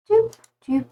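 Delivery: high playful doop noises; playful doop sounds. Shown as a demonstration. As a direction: bounce out little sound effects. Sound effects for skill points.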